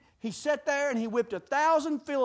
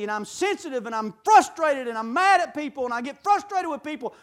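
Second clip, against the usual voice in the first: normal talking voice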